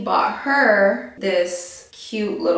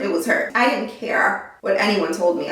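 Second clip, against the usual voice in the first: ordinary talk, nothing marked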